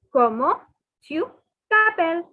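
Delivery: ordinary talk, nothing marked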